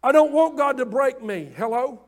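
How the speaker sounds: loud, high, full-force pulpit preaching; fiery preaching. Acted. Pastor preaching to his church, full-force preaching. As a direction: preach it hard to the congregation.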